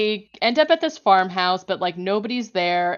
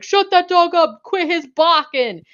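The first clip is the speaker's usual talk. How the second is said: high, loud imitation of an irate soldier; exasperated yelled command, imitated; mock angry yell; 10 semitones higher, 8 dB louder than usual. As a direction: shout it like an order, hammed-up. Acting angry dog-killer soldier yelling.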